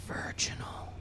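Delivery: hushed breathy whisper of awe. Pretend. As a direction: breathe the word like a secret. Whispered aside about Oreos.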